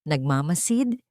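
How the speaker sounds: usual speaking voice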